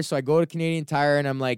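ordinary delivery

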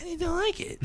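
put-on high kid voice. Son explaining nothing to dad, high soft kid. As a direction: pipe it like a small boy. Son's reply.

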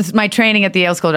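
ordinary delivery

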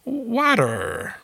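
exaggerated American R on "Water"; American pronunciation. Shown as a demonstration. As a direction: say "Water" American, stretched, with a hard R. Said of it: "as they call it".

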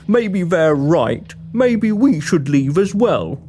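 low, slow man's voice; deep-voiced, slowly giving up; defeated monster hunter. Walton wavers.

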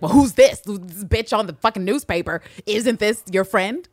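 loud, accusing retelling; hammed-up confrontation, starting high and loud; mock outraged. Husband confronting wife over newspaper, mock outrage.

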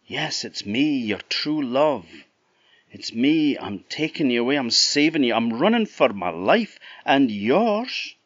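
breathless, determined to save him; girl in mule form. Acted. The girl telling Jack she's rescuing him, urgent.

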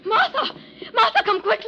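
high, loud cry for help; urgent calling out. Acted. Wife summons the servant to her collapsed husband, urgent shout. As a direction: shout urgently for someone to come.

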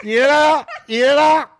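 playful high voice stealing a nose; high sing-song baby talk. Pretend. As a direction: coo it like teasing a toddler. Got your nose game voice.

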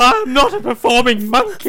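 hammed-up outrage; indignant dog. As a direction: protest like a fed-up pet. Dog raging at owner.

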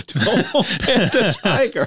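laughing. Real, not acted. Repeats the cohost's line, laughing.

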